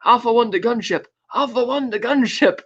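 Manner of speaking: put-on radio dispatch voice; walkie-talkie call. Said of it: "he gets on his walkie-talkie".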